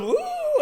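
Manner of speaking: high swooping ooh; tummy drop ooh. Retold or imitated. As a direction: let your stomach drop on the sound. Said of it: "your tummy goes".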